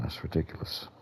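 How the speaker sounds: usual speaking voice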